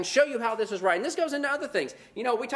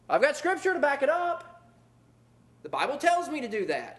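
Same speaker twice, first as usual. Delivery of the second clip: mock indignation; 5 semitones higher than usual. Preacher mocks offended brother with raised indignant voice. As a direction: ham up an offended churchgoer. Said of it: "we'll use verses like".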